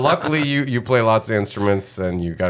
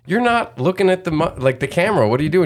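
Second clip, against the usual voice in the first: higher, louder mock mom nagging; mom scolding; 5 semitones higher, 3 dB louder than usual. Son imitating his mom scolding him. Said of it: "she'd say".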